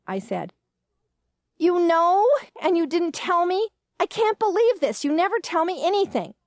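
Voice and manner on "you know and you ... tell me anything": high, outraged disbelief; indignant outburst. Acted. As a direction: protest at being left out, voice climbing. Daughter indignant at being left out.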